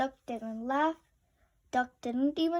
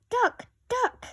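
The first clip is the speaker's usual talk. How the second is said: high, loud yell of a name; 11 semitones higher, 6 dB louder than usual. A narrator's reading. Splat yelling after his duck. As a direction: call out for the lost duck. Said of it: "yelled Splat".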